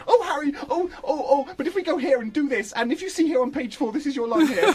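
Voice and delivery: hammed-up whiny explainer; mocking Hermione. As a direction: mimic a know-it-all pointing at the page. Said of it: "And she goes".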